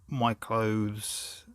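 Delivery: ordinary talk, nothing marked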